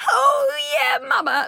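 high exaggerated porn-star cry; porn moan. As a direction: ham up a porn-style moan. Mock porn reaction.

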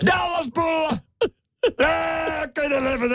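very high-pitched shouty character voice; put-on tepoilmies voice pushed way up high. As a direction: screech it out in a sustained high comic voice.

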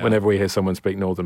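ordinary talk

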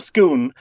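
Scone pronounced "Skoon"; Scone with an oo sound, as the Scots say it; Scottish pronunciation. Shown as a demonstration. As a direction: rhyme Scone with moon. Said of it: "as it's pronounced in Scotland".

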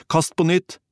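high, urgent shout; urgent call. A narrator's reading. Drowning man to comrade with the rope, high urgent cry. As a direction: call out a desperate order. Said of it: "ropte han".

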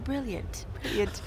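soft, light imitation of a British accent on brilliant. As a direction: drop into a quiet mock British accent. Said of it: "That's a good accent".

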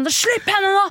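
high shouted order; stumbling over in heels, shouting high; retold shout. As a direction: bark it across the platform. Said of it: "så jeg stabber meg liksom bort".

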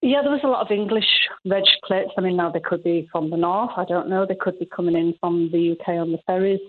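usual speaking voice

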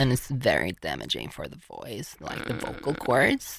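soft, creaky fry held to the end; vocal fry. Shown as a demonstration. Explaining vocal fry damage, soft creaky fry. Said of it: "när man pratar så här".

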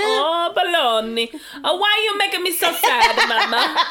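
hammed-up Italian lament; theatrical wounded Italian accent; mock Italian. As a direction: plead with mama in fake Italian. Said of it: "In English with an Italian accent".

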